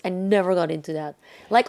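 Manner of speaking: usual speaking voice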